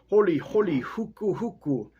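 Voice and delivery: high mock grinding chant; grinding stone noise. Grinding stones mocking the wooden men, grinding chant. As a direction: mimic a stone grinding corn. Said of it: "imitation of the noise made when the corn is being ground".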